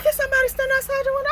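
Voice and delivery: high fast alarmed warning; boyfriend's retold alarm, high and fast; alarmed urgent. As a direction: rush it out in alarm.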